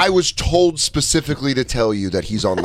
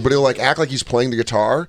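ordinary delivery

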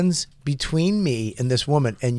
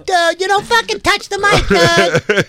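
very high mock-hysterical shout; outraged yell; 5 dB louder than usual. Comic agreeing that the mic is off limits, high outraged yell. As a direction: shriek the rule in disbelief.

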